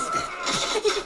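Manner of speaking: high breathy leprechaun cackle. Acted. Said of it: "I like doing Leprechaun's voice".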